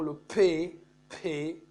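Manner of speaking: "P" said with a puff of air. Shown as a demonstration. Teacher contrasting P with B, "P" released with audible air. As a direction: pop the "P" on a burst of breath. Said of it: "on trouve toujours l'air".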